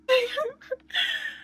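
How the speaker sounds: high, breathless squeal of laughter. Real, not acted. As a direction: crack up at yourself. Laughs at own accent.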